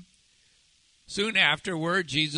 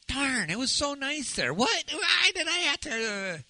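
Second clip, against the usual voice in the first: high-pitched, hammed-up protest; playful, much higher mock dismay; mock complaint; 10 semitones higher than usual. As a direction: complain like you were dragged back from paradise. Acting the revived man's reluctant reaction.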